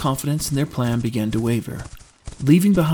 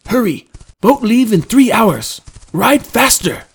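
high, loud, emphatic urging; urgent shout; 10 semitones higher, 9 dB louder than usual. Acted. Trendon hurrying Drew to catch the boat, urgent shout. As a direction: call out forcefully over hoofbeats. Companion urges Drew to ride.

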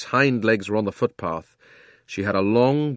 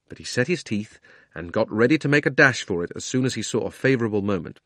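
ordinary delivery, nothing marked

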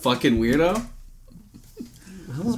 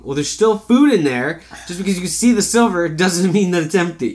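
louder, higher exasperated arguing; exasperated cat owner; 5 semitones higher, 6 dB louder than usual. Acted. Owner arguing with the cat over its food bowl, exasperated. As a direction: plead your case in mock exasperation. Acted argument with texting cat.

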